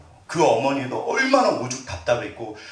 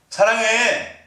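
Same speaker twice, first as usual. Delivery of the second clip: high, loud and hard; harsh, forceful delivery; 9 semitones higher, 5 dB louder, much slower than usual. Shown as a demonstration. Preacher showing forceful words that miss, loud and hard. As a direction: say it too strongly, as a bad example. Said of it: "이 말 세잖아요".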